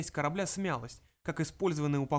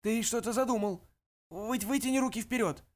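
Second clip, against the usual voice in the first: slurred from lack of oxygen; short of breath, words slurring; gasping for air; 7 semitones higher than usual. Acted. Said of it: "речь стала несвязной из-за кислородного голодания".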